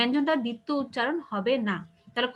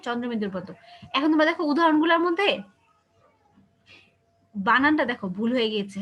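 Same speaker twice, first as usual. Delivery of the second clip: usual speaking voice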